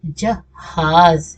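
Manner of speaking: जहाज drawn out very slowly, ending on a voiced z; slow z sound. Shown as a demonstration. Teacher answering her own question, slowly modelling जहाज with z. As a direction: pronounce जहाज slowly, landing on a clear z. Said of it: "जहाज क्या होता है?".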